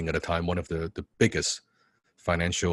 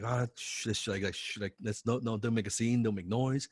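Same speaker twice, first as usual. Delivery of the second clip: hushing; 2 semitones higher, 9 dB quieter than usual. Retold or imitated. Idol hushing the noisy fan.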